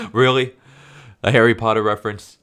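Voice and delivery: loud, incredulous and a bit rushed; heated disbelief. Real, not acted. Ranting at the comic's students, loud and incredulous. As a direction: let the outrage spill out fast. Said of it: "I know I'm getting kinda heated".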